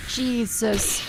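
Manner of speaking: usual speaking voice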